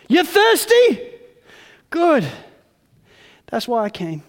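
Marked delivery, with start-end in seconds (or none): 0.1-2.3 high, loud question; loud challenge. Acted. Jesus challenging the thirsty crowd, loud. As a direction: fling the question at them.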